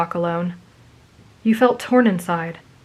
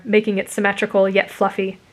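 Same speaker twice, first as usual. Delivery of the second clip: plain reading voice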